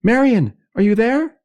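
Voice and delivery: very high, loud anxious call. A narrator's reading. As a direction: call into the dark for a reply. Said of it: "Louise called".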